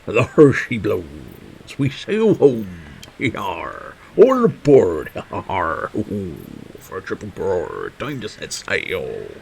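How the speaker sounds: drawn-out seafarer calls, falling away at the ends; pirate sea captain. Acted. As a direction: call the crew aboard like a pirate. Calls out as a seafarer setting sail.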